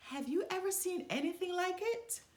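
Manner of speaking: high, fast, astonished crow voice; amazed. A narrator's reading. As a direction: gasp it out in wonder. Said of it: "they asked each other".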